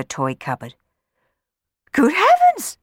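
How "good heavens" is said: very high, loud astonished cry; startled cry. Acted. Rowan seeing Sammy's sooty head, astonished cry. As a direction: gasp it out in shock. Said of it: "cried Rowan".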